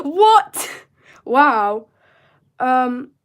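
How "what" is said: high, loud startled yelp, caught off guard; a real jolt of surprise at the chat; startled what. Real, not acted.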